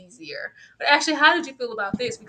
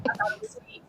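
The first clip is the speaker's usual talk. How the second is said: whisper; 8 dB quieter, faster than usual. Real, not acted. Soft whispered confession.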